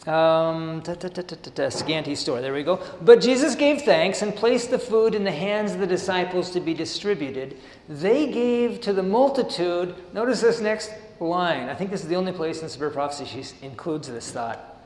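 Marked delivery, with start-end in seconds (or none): 3.0-10.0 measured sermon reading of the text; steady devotional reading voice; reading aloud. Acted. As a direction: read the passage out to the congregation. Reads the passage aloud.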